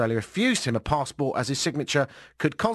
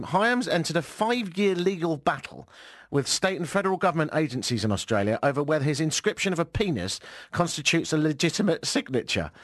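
ordinary talk

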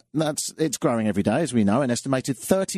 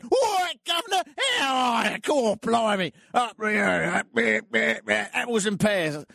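mock Cockney shout; 8 semitones higher than usual. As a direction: bellow it like a coworker mocking your Cockney accent. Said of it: "without saying".

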